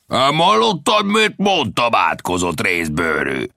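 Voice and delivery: high-pitched bandit yell; bully shouting an insult at his target; angry shout. A narrator's reading. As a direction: demand an answer at a shout. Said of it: "kiáltotta a bölényképű".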